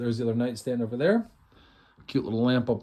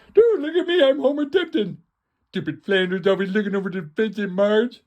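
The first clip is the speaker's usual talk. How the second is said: much higher, louder cartoon dad voice; Homer Simpson; 8 semitones higher, 5 dB louder than usual. Host showing off his old cartoon impression, loud and high. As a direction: squeeze out a goofy cartoon dad. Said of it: "Like Homer Simpson".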